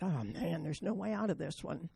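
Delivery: soft hopeless inner thought; quiet despair. Retold or imitated. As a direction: say it under your breath, defeated. Trapped teen's inner thought.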